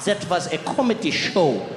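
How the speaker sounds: ordinary delivery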